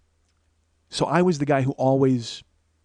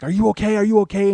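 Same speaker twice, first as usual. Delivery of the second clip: higher, louder, urgent mom checking; frantic mom's concern, raised pitch; worried mom; 7 semitones higher, 7 dB louder, faster than usual. Retold or imitated. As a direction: imitate an alarmed mother calling out. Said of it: "my mom's like".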